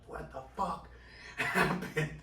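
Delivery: high, breathy mock scream, held back; imitated freak-out. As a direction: imitate a doctor tweaking, breaking into a laugh. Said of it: "He's screaming a little bit".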